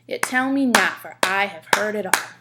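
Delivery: loud iambic beat hitting "tell", "not", "I", "heard", "all"; rhythmic verse reading, every second syllable punched; clapped stressed beats. Shown as a demonstration. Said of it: "I'm gonna read the whole thing one more time".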